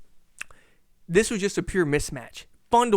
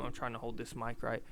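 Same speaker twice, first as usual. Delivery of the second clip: muttered aside; 6 semitones lower, 13 dB quieter than usual. Real, not acted. Aside while adjusting mic.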